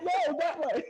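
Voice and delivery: high laughing yell; mock shout. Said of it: "It's like".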